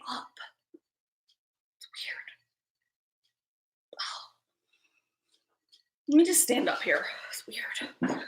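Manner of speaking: soft unvoiced whisper while standing up. Real, not acted. Vlogger whispers to chat while standing up, whispering. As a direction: breathe it out, barely audible.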